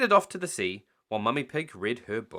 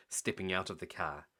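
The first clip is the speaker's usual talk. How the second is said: ordinary delivery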